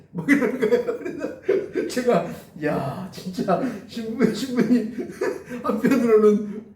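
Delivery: real incredulous laughter through speech; laughing. Real, not acted. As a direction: crack up in disbelief while talking. Preacher laughs at sisters' lavish gifts.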